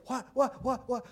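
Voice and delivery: high, quick, puzzled what what; wife asking startled and confused. Retold or imitated. Wife turning to husband, puzzled at his tears, startled. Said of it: "And she's like".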